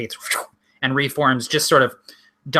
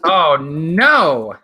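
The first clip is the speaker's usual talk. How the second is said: dismay; 4 semitones higher, 5 dB louder than usual. Real, not acted. Game master reacts to roll.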